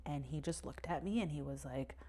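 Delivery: normal talking voice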